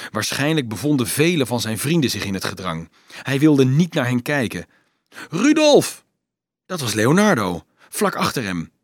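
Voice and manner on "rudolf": high, loud name call. A narrator's reading. Leonardo right behind Rudolf, shouting his name high and loud. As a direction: shout to catch his attention.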